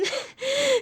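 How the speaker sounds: a teary half laugh, half sob; laughing through real tears; laugh-cry. Real, not acted. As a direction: break into a crying laugh. Frustrated tears over Facebook.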